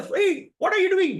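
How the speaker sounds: alarmed at being carried; startled protest. Retold or imitated. The devotee cries out as the priests lift him, startled.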